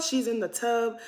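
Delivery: ordinary talk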